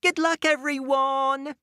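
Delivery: high, cheery call. Acted. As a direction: call out to friends. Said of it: "Unicorn called out to her friends".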